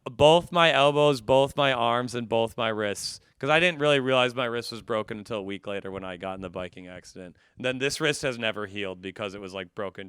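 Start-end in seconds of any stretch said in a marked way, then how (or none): none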